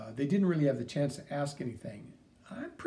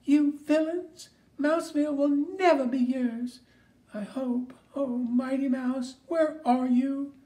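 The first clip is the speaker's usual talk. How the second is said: distressed cartoon mouse; 9 semitones higher, 5 dB louder than usual. Cartoon mouse cries for rescue.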